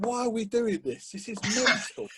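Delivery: high, incredulous retold complaint; exasperated protest. Player moaning to himself about pointless running, mock exasperated.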